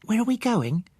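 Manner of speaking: put-on high child voice, curious; a child asking on the road; curious little girl. Child Susan voiced in story.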